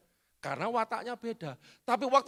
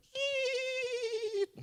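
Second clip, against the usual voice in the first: imitated screech of brakes. Truck braking noise.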